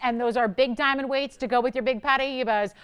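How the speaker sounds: ordinary talk, nothing marked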